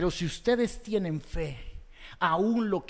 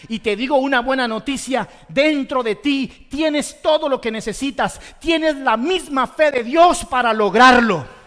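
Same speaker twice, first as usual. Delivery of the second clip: louder, building to a full preaching shout; forceful preaching; 5 semitones higher, 10 dB louder than usual. Real, not acted. Preacher rousing the congregation with a loud proclaiming shout. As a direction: proclaim it to the whole church. Sermon climax, proclaiming good news.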